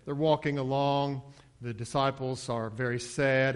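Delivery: usual speaking voice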